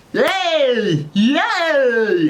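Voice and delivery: high, loud drawn-out mock cheer; calling out. Imitating a man calling out on set, high silly cheer. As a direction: whoop it like an annoying attention seeker. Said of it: "just, like, constant, like, calling out".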